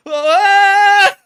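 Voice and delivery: a sudden hammed-up shriek of dismay; mock anguished yelp. Imitating a Russian commander who lost his last vehicle, with a loud mock yelp. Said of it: "And he went".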